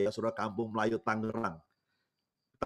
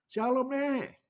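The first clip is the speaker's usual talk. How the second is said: very high, drawn-out hailing call; imitated pastor's high-pitched holler; calling from afar; 14 semitones higher, slower than usual. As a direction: greet an old granny from far off, stretching the words. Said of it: "gembalanya langsung teriak. Dari jauh udah teriak".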